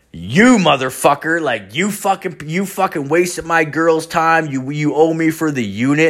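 mock outraged accusation; threatening. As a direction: retell the threat with heated force. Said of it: "long paragraph threatening me".

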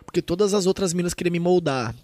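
ordinary talk

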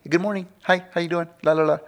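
high, sing-song mock cheer; mimicking a bubbly friend's greeting; cheery imitation. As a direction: chirp it like someone endlessly happy. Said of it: "she's just constantly".

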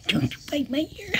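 squeaky high, soft and quick. Real, not acted. Owner scolding the cat playfully, squeaky baby voice. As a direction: coo it at the cat in a tiny voice.

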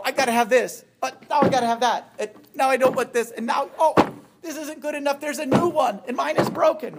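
high, whining list of cravings, jumping from thing to thing; mock a restless consumer who always needs the next thing; whiny grasping wants. Mocking the restless consumer for the audience, high and whining. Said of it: "You're not like, you know".

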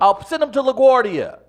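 loud, high New York threat; New York accent. Shown as a demonstration. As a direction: announce it with brash New York attitude. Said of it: "working on my New York accent and attitude".

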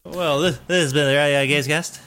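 pinched nasal announcer sign-off. Pretend. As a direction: squeeze it through the nose like a radio host. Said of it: "my little nasally voice there".

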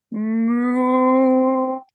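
mooing like a cow; storyteller's moo. Acted. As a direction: give a drawn-out moo.

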